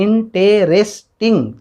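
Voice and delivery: "इंटरेस्टिंग" as four distinct beats; syllable by syllable. Shown as a demonstration. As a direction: say "इंटरेस्टिंग" with each syllable set apart. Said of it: "चार बार हम बोल रहे हैं". ("इंटरेस्टिंग" के चारों हिस्से अलग-अलग बोलें)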